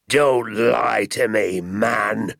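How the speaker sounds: slow, loud, furious bark at the father; angry yell. A narrator's reading. Angry landowner yelling at the father. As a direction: spit out each word in rage. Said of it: "yelled Mister Hazel".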